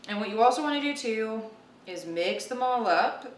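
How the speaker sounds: usual speaking voice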